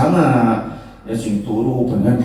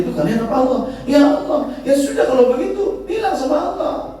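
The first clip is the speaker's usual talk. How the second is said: Idris arguing; 8 semitones higher than usual. Retold or imitated. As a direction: argue your point, high pitched.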